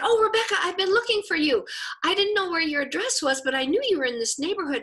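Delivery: high, loud, rushed eager gush; imitating an excited woman calling out; excited greeting. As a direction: gush at her like an old friend. Woman eagerly greeting from her van.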